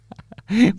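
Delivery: high burst of laughter; bright high laugh at a funny name; amused laugh. Real, not acted. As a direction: laugh out loud at the silly title. Laughs at album title.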